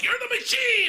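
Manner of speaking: very high, loud mock chorus; retold crowd cheer. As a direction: holler it back like a delighted room. The room echoes it back, retold.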